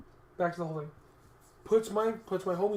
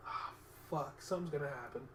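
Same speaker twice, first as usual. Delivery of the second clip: muttered dread; 3 semitones lower, 9 dB quieter than usual. Retold or imitated. Dreading the cop pulling up.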